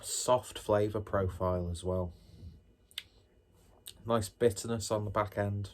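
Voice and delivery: ordinary delivery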